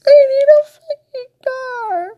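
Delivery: real wailing scream, drawn out; very high, very loud anguished cry; crying shout. Real, not acted. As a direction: scream it through tears, slowly. Crying breakdown.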